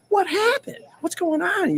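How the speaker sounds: retold question, very high and soft; high, gentle "what's wrong" voice; puzzled concern. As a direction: ask in high, hushed mock alarm. Retells asking upset barmaid.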